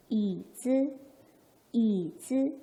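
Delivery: 이 drawn out and dipping, 즈 short and light; pronunciation demo. Shown as a demonstration. Teacher models chair like grandmother, tone demo. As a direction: model 이즈 syllable by syllable. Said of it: "이렇게 소리를 내시면".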